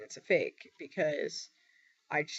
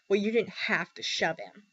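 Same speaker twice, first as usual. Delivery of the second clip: mild snarly comment; 2 semitones higher than usual. Retold or imitated. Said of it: "gave me a snarly comment".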